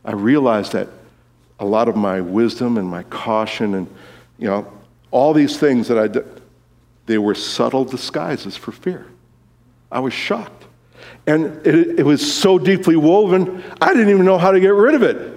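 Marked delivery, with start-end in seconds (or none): none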